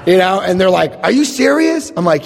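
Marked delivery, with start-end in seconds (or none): 1.0-1.9 high-pitched disbelief; incredulous. Retold or imitated. Family answering his apology, incredulous high question.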